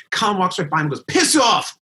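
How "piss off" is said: high, loud mock outburst; angry snub. Angry singer brushing off bass player, loud and high. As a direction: imitate a furious singer lashing out.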